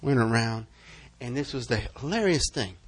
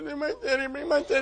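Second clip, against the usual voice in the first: mock tearful prayer; 11 semitones higher, faster than usual. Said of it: "Praying in tongues and crying".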